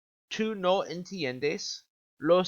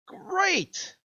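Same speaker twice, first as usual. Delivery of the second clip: upbeat praise. Acted. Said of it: "teacher praising students".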